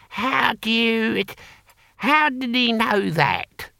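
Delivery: high, slow, drawn-out bafflement; baffled surprise. A narrator's reading. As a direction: puzzle it out slowly in a high character voice. Boy Willie baffled at being identified.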